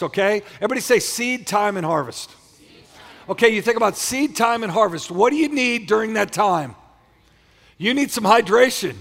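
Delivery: loud, high-pitched preacher; rousing pulpit call to the congregation; fired-up preaching. Real, not acted. Preaches at full force.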